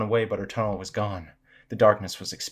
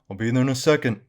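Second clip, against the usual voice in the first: moderately raised call, louder; yelling back; 3 semitones higher, 7 dB louder than usual. A narrator's reading. Dad answers the kids from downstairs, mild yell. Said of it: "he yells back up the stairs".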